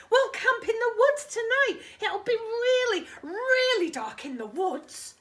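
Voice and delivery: high, loud, laughing eagerness; thrilled, laughing; excited. Acted. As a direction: gush about the plan. Tiger excited about camping.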